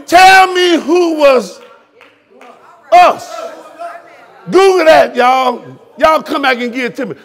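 loud, high-pitched shouting from the pulpit; full-force preaching. Real, not acted. Preacher challenging the internet researchers in his congregation, shouting. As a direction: hammer the challenge at them, calling out.

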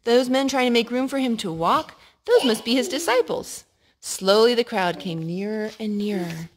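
usual speaking voice

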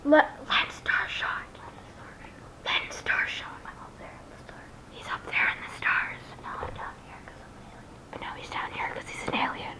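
unvoiced whisper, hushed. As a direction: whisper it like a secret. Whispered alien intro bit.

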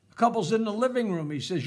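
usual speaking voice

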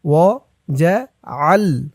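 وجعل- slow, with ع pressed hard from the throat; throaty ain. Shown as a demonstration. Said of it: "আইনের উচ্চারণটা গলার ভেতর থেকে শক্ত হয়ে". (وجعل-এ আইন গলার ভেতর থেকে শক্ত করে)

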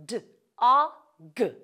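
D, a, g sounded out one at a time; stretched sounds. Shown as a demonstration. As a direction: say "D", "a", "g" as separate sounds with gaps. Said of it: "Let's stretch out the sounds".

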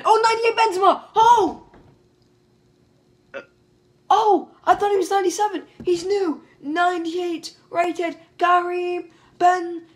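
high, loud, overjoyed yelling; wildly excited, high-pitched celebration; ecstatic shout. Real, not acted. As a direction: shout in disbelief at a huge win, stretching the name. Thrilled at pulling the top card.

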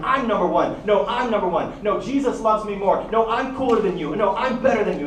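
loud, high one-upping argument; mock squabbling. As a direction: bicker back and forth, each claim pitched high and loud. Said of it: "these guys are fighting and they're battling".

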